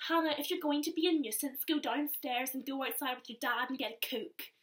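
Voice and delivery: a fed-up parent; scolding mum. Retold or imitated. Mum telling Hannah to go find dad, exasperated.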